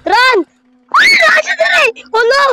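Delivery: very high loud drawn-out cry to run; panicked yelling. Real, not acted. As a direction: shout as you flee. Monster chasing, fleeing.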